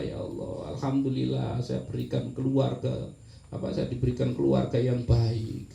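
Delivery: a hushed prayer of gratitude; grateful prayer. Shown as a demonstration. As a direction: thank God quietly and sincerely. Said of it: "zikir kan".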